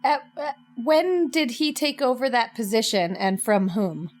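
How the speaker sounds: ordinary delivery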